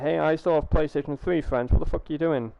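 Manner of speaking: a touch of mock outrage; angry gamer rant. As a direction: voice the annoyed players, lightly heated. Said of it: "very pissed off".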